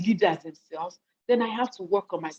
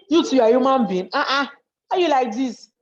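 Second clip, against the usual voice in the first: raised, louder disbelieving scold; mock scolding; 5 semitones higher, 6 dB louder than usual. Speaker mocks how you react to difficult people, loud disbelieving scold. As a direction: scold them as if they're barely human.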